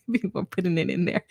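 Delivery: ordinary delivery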